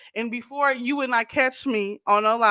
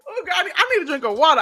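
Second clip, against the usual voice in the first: laughing through it; 5 semitones higher, faster than usual. Real, not acted. Laughing off emotion, fast.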